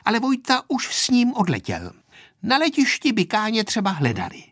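put-on high child's polite explaining; lightly voiced helpful little girl; well-behaved girl. A narrator's reading. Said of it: "řekla způsobně Aneška".

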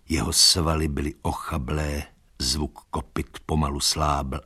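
usual speaking voice

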